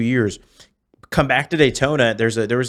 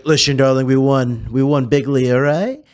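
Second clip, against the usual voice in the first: emphatic, louder impression with slurred s; Donald Trump; 3 semitones higher, 4 dB louder than usual. Impersonator answering a woman heckler, boastful Trump voice. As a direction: put on the boastful politician's voice. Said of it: "I'm not actually Trump".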